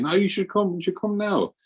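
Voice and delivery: lightly voiced guru, high and pressing; a gentle mock insistence; urging guru. Guru telling the disciple to come now, high mock urging.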